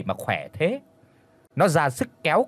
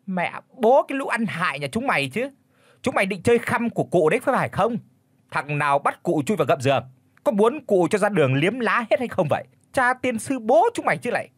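angry scolding; 6 semitones higher than usual. A narrator's reading. Old mistress pointing and scolding her servants. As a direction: tear into the useless servants. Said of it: "chỉ mặt đám người ở mà chỉ chiết".

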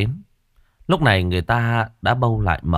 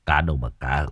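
sad father; 5 semitones lower than usual. A narrator's reading. Father answering his son about the bodies, sad and low. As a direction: speak heavy with sorrow, voice sinking. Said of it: "cha của nó buồn bã".

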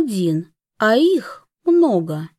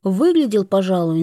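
ordinary delivery, nothing marked

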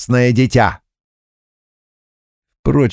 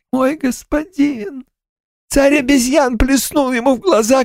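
ghost voice. Acted.

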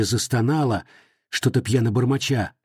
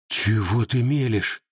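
ordinary delivery

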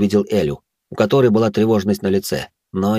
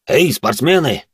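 dwarf voice; 6 semitones higher, slower than usual. A narrator's reading. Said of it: "появился за нашими спинами гном".

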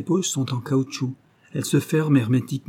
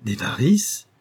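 startled echo of a question, mildly done; exclamation étonnée; 3 semitones higher, slower than usual. A narrator's reading. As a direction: exclaim it back in mild disbelief. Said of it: "s'écria le jeune chef de cabinet". (exclamation légèrement étonnée)

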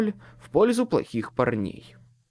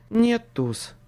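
higher, lightly voiced weary woman; a touch of female weariness; tired woman; 8 semitones higher, 3 dB louder than usual. A narrator's reading. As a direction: sigh it as a worn-out woman. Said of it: "Женский голос звучал совсем устало".

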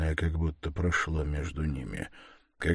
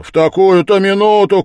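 high, very loud exclamation; a loud cry of disbelief; indignant shout; 13 semitones higher, 15 dB louder than usual. A narrator's reading. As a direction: shout it out in protest. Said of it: "крикнул Разумихин".

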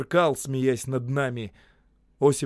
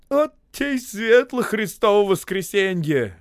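high, slow, loud lament; young worker grieving over Easter; sorrowful exclaim; 9 semitones higher, 8 dB louder, slower than usual. A narrator's reading. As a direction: cry out in dismay at the lost holiday. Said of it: "горестно воскликнул Сашок".

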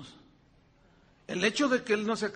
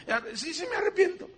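mock forced confession; 11 semitones higher, 3 dB louder than usual.

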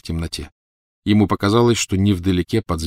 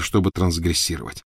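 plain reading voice

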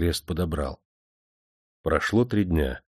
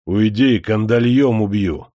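louder, slower menacing shout; threatening yell; 3 semitones higher, 6 dB louder than usual. A narrator's reading. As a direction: threaten to kill with chains. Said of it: "харкнул под ноги хозяину Кержак".

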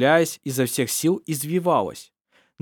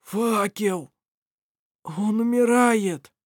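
anguished moan; 9 semitones higher, slower than usual. A narrator's reading. Irovar keeps moaning for the torch.

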